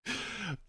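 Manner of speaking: soft, high-pitched giggle; high soft laugh. Real, not acted. Streamer amused by the fan fiction, soft high giggle. Amused by the story just read.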